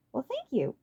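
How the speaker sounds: high, smug thank-you; pleased with himself, smirking; smirking smugly. A narrator's reading. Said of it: "he smirked smugly".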